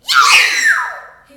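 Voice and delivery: huge, very high yelp of pain; wolf shrieking as he hits the boiling water; wolf's scream. Acted. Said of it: "he said".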